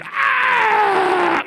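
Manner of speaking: loud mock demon yell; demon scream. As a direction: let out a demon's screech. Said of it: "you can hear the demon".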